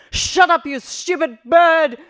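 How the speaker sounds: fed up shout at the beeping; mock yell at bird. Mother yells at chirping.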